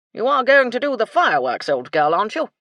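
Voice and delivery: put-on very loud, blustering old officer; insistent colonel. A narrator's reading. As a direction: boom the question, high at first.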